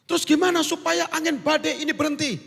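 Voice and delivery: high, pressing question; sailor questioning. Acted. Sailor demanding Jonah's name, high and pressing.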